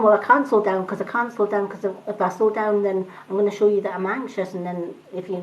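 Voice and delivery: fast, rushed imitation of an anxious talker. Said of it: "talk at a hundred miles an hour".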